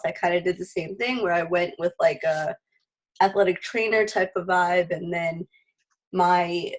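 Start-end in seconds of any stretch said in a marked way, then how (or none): none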